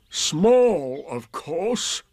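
weary, drawn-out donkey retort; put-on gloomy sarcasm; Eeyore. A narrator's reading. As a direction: start strong, then let it drop away. Eeyore answers Rabbit.

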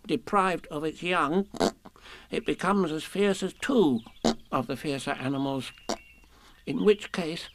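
a small animal hedging anxiously; timid Piglet. A narrator's reading. Piglet nervously explains.

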